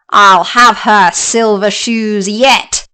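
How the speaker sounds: slow, relishing witch threat; the wicked witch gloating over the silver shoes, slow. Acted. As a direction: drag it out, savouring the plan.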